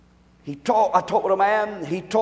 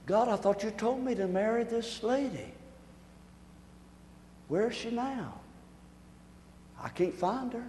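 hushed, softer, worried husband asking around; Hosea searching; 4 semitones higher, 7 dB quieter than usual. Retold or imitated. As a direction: quietly plead for news of his wife. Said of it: "Notice Hosea".

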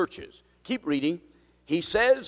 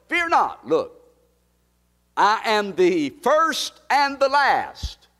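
higher, louder, slowed declaration; ringing proclamation; 7 semitones higher, 7 dB louder, much slower than usual. Acted. Pastor declaring Christ's words to his church, ringing. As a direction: declare it like a promise.

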